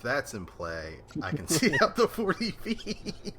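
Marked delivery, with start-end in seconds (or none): 1.2-3.3 laughing loud and high. Real, not acted. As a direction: break into laughter mid-line. Player laughs at the joke.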